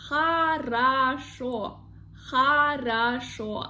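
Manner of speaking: хорошо drawn out as ha-ra-SHO; slow model pronunciation. Shown as a demonstration. Teacher showing learners a popular word, хорошо slowly as ha-ra-SHO. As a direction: say хорошо slowly, "ah" twice, stressed final O. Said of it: "We say".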